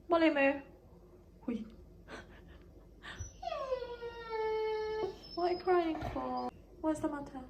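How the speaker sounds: calm the crying little one gently; cooing to a pet. Real, not acted. Cooing to upset Molly.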